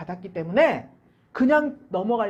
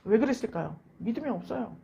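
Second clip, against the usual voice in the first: ordinary talk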